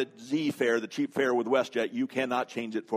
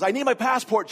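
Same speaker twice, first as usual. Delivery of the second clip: high, loud mock panic; freaking out; 9 semitones higher, 5 dB louder than usual. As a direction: imitate a panicking traveler. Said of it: "he's freaking out".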